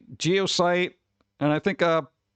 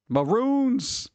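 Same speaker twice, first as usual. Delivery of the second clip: Bugs Bunny maroon; 8 semitones higher than usual. Pretend. Looney Tunes maroon joke.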